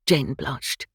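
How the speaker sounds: ordinary delivery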